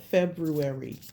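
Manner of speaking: February with the first r clearly sounded, Feb-ru-ary; February as spelled. Shown as a demonstration. Reader insists on saying February as spelled. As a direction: stress every letter of February. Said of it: "I'm saying it how it's spelled".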